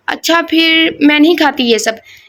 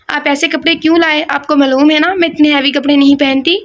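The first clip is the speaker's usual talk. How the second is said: plain reading voice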